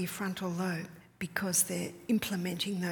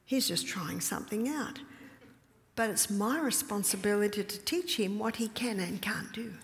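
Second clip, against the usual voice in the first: normal talking voice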